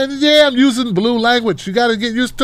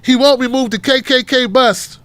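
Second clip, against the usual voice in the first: usual speaking voice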